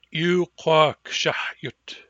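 "shahyutsish" spelled out very slowly; "shahyutsish" stretched slow for learners; slow model word. Shown as a demonstration. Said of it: "you will pronounce them with me".

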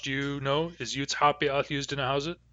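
ordinary talk

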